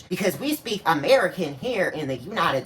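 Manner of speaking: mock her with an All-American twang; mock Southern twang. Mocking singer's slurred words with twang.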